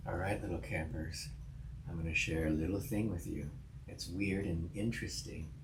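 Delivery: soft, hushed creepy uncle. Acted. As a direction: lure the kids in like a creepy uncle. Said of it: "Is that why you're using creepy uncle voice?".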